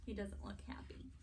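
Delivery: ordinary delivery, nothing marked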